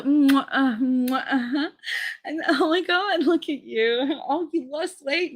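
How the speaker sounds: high-pitched mock air kisses and compliments; catty fake sweetness. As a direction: coo phony flattery in a high voice. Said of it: "just the cattiness back and forth".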